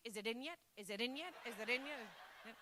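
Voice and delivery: soft, low uncertain asking; half-whispered doubtful query; quiet unsure question. Pretend. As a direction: ask it timidly, lost. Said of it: "Guys would be".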